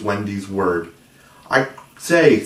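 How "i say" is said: higher, louder eager call; eager cry. A narrator's reading. John proposes flying outside, eager cry. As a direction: burst out with an idea. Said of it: "cried John".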